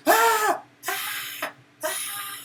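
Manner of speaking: high-pitched frightened cries, acted; scared gasps. Shown as a demonstration. Teacher showing pupils what scared is, frightened gasps. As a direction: gasp "Ah!" in mock fright. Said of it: "Scared is".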